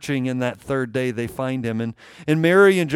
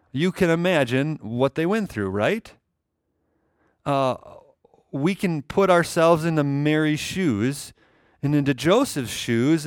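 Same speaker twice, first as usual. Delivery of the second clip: ordinary delivery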